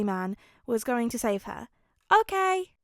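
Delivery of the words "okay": put-on high child's okay; a happy small child agreeing; little girl. A narrator's reading.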